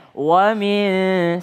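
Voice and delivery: WA MIN unhurried, both sounds given full length; relaxed. Shown as a demonstration. Teacher modelling wa min, relaxed and drawn out. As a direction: stretch WA MIN at an easy pace. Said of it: "santai aja".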